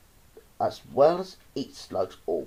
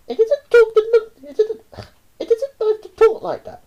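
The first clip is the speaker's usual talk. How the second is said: mother scolding; 9 dB louder than usual. A narrator's reading. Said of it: "My mother said".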